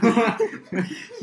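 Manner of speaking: laughing. Real, not acted. Guest laughing at the joke.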